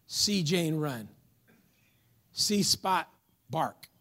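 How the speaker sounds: "See Spot bark" read slowly, word by word; slow child reader. Shown as a demonstration. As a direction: read it like a kindergarten primer. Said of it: "still at that elementary level".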